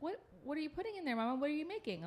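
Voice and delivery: put-on high, quick toddler voice; little boy voice. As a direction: chirp it like a curious toddler. Son asking mom about coffee.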